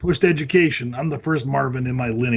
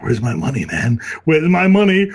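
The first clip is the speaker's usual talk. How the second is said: loud mock demand, louder at the end; Family Guy quote; 2 semitones higher, 8 dB louder than usual. To his girlfriend at arrival, loud mock demand. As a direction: demand it like the cartoon thug. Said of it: "I was like".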